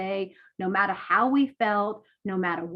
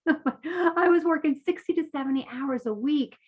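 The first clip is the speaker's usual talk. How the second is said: higher-pitched, with a laugh leading in; laugh, then say it in a raised, amused voice; amused repeat; 6 semitones higher than usual. Real, not acted. Coach commiserating with webinar audience over long hours, amused repeat.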